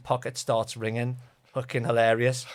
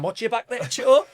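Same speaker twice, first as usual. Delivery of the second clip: high, louder shout over the shoulder; mock driver calling back; 11 semitones higher, 7 dB louder than usual. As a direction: call it back while reversing.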